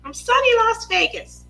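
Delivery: high, swooping pageant intro; Miss America voice. Pretend. Streamer joking about her introduction, in a Miss America voice. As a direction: play a pageant contestant on the mic. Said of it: "working on my Miss America voice".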